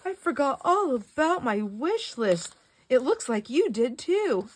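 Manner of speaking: slow, high, loud teasing singsong; high teasing drawl with a laugh breaking in. Retold or imitated. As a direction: draw it out high and playful, giggling. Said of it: "I'm like".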